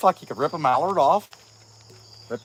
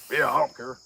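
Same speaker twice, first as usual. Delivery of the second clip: acting hard; 2 semitones lower, 4 dB quieter than usual. [name] pretends to viewers he isn't worried, mock hard indifference. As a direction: put on a mock tough, couldn't-care-less act. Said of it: "I could act all hard, like".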